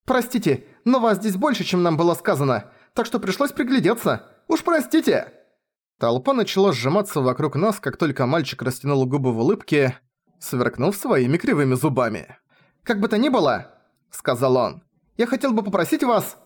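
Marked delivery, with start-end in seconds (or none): 0.1-5.4 put-on much higher, chirpy boy's voice; impudent boy. A narrator's reading. 12.8-13.7 put-on high-pitched young boy; boy's voice. A narrator's reading. 15.2-16.4 put-on high-pitched young boy; boy's voice. A narrator's reading.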